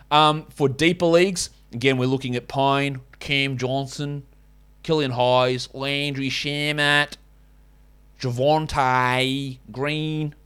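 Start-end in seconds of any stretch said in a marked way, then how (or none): 2.5-10.3 slow, drawn-out mock Aussie names; bad Australian accent. Shown as a demonstration. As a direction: stretch every vowel in a fake Australian accent. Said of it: "I'm sick of doing that".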